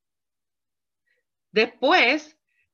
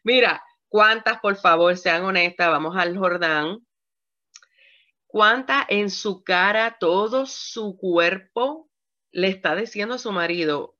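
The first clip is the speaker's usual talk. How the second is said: normal talking voice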